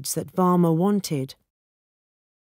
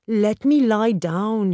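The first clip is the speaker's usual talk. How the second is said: higher, slow, drawn-out plea; begging; 5 semitones higher, 4 dB louder, slower than usual. A narrator's reading. Weak old father wanting to lie back, begging. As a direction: plead with the pitch raised. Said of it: "he begged".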